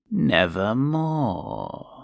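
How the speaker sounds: slow croaking Nevermore. A narrator's reading. The ominous bird croaking its one word, slow. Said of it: "meant in croaking".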